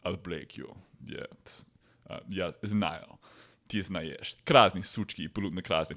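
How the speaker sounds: low, soft, menacing thug growl with Russian words; lightly voiced Russian gangster threatening; a hint of a vor v zakone boss; Russian mobster.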